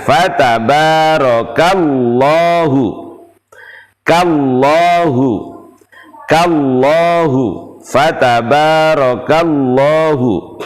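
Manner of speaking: full heavy lo in kallahu; thick lah. Shown as a demonstration. Teacher models a heavy Allah for students. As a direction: round the lah in fatabarakallahu heavy. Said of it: "Contoh misalnya".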